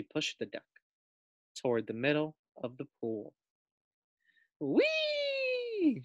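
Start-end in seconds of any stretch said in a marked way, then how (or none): 4.6-6.0 very high drawn-out squeal; gleeful whee. A narrator's reading. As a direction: let it soar with delight. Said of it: "she said".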